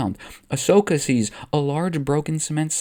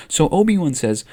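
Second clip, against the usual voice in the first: plain reading voice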